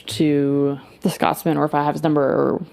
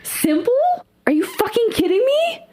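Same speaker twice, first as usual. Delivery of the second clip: outraged disbelief. Acted. Glade outraged at the Scotsman.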